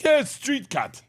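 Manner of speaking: high, loud broken English; hammed-up neighbor shrugging it off; mock foreign accent. Neighbor confirms to Leanne, mock accent. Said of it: "he's like".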